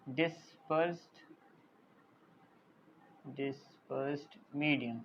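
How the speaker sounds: soft and slow, broken into pieces; slow dictation. Real, not acted. Teacher writes the second term, slow soft dictation. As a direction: say it under your writing, syllable by syllable. Writing the term while saying it.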